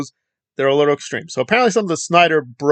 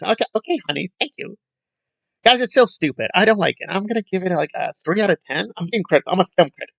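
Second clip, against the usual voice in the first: continued mock voice; 3 semitones higher, 3 dB quieter than usual. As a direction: keep the mocking sing-song going. Mocking the critic further.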